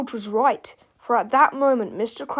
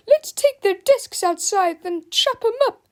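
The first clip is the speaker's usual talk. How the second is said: high, loud teacher voice; gleeful cry; 10 semitones higher, 7 dB louder than usual. A narrator's reading. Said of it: "cried Miss Ribble".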